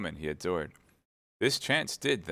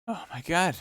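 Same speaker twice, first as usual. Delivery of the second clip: pitched-up alarmed exclamation; cried; 11 semitones higher than usual. Acted. Wife alarmed at her husband's howling, high cry. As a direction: gasp it out high in panic. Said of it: "cried Madame Bonacieux".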